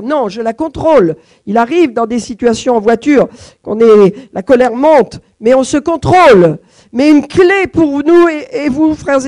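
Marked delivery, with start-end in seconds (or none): 1.5-9.2 rising preaching. Acted. Preacher urging brothers and sisters, building intensity. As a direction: build the warning up to the key.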